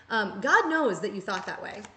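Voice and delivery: usual speaking voice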